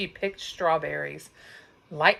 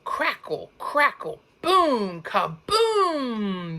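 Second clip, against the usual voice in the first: higher crackle, crackle building to kaboom; thunder crackle; 6 semitones higher, slower than usual. A narrator's reading. Narrator to child listeners, thunder crackling into a boom. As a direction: crack then boom like a storm.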